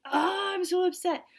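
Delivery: high, loud whine of fake distress; whiny mock upset. Balaam whining about his talking donkey, hammed-up and high. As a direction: whine it like a sulking child. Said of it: "is like".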